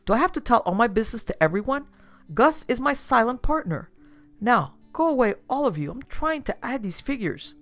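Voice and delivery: high-pitched testy outburst; exasperated, harried businessman; irritated. A narrator's reading. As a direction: shoo them off impatiently. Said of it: "E P Barstow glared".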